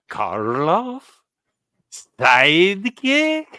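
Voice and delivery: put-on slow, high Slavic-accented question; lightly put-on Lugosi accent. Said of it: "I'm doing a terrible Slavic accent".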